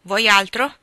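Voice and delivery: loud, curt question; annoyed. A narrator's reading. Old Lawrence receives Jo returning a book, annoyed. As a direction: snap it, barely hiding the irritation. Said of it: "Il vecchio era accigliato, seccato".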